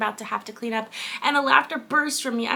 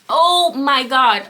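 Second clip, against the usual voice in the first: loud, drawn-out, higher gasp of disbelief; dramatic shock; 4 semitones higher, 12 dB louder, much slower than usual. Real, not acted. Reader reacting to the book, stunned.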